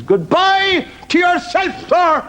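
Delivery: very high, drawn-out pig squeal; talking pig. Acted. Pig saying goodbye to the ventriloquist, squealed. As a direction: squeal a long polite farewell. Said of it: "and the pig says".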